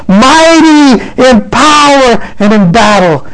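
high, exultant scripture proclamation; impassioned preaching. Real, not acted. Preacher proclaiming the King of glory to the congregation, exultant. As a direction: cry out the verses high, stretching the big words.

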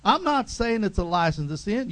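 ordinary delivery

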